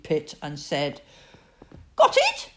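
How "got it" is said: small, high, squeaky little piglet voice. Acted. Narrator voicing Piglet fretting about the trap, high and squeaky. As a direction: squeak it out high like a tiny worried animal.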